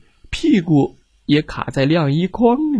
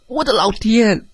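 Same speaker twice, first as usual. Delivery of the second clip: startled cry; 7 semitones higher than usual. Acted. Crash in the kitchen.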